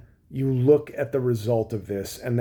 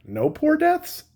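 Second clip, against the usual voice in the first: high mock-innocent squeak with a rising end; mock rare MAGA voter; slower than usual. Mocking the rare MAGA voter who objects, squeaky high pitch. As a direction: lightly mimic a timid voter in a much higher voice. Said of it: "and the rare maga who's like".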